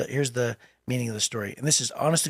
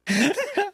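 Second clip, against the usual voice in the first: real laugh, pitched much higher. Real, not acted. Laughing at the friend's pain joke, high real laugh. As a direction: let a high laugh burst out.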